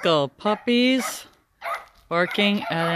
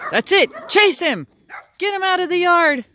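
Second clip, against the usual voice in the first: excited cheering; 10 semitones higher than usual. Real, not acted. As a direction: urge the puppies to chase. Cheering puppies to chase.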